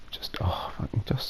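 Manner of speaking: soft unvoiced mutter to himself; whispering. Real, not acted. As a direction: whisper while concentrating. Concentrating, placing the building.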